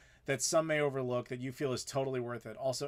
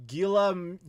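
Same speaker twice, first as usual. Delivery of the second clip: tentative French name; 5 semitones higher, 6 dB louder than usual. Shown as a demonstration. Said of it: "my terrible French pronunciation".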